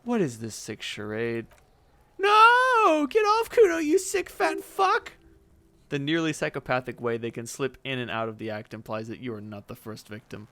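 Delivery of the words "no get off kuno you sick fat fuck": very high, loud shriek; shrill screaming girl. Acted. Girl screams at Kuno, shrill and loud. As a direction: scream like you're attacked.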